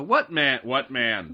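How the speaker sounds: a hint of a startled mother; mom voice. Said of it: "trying to find my mom voice".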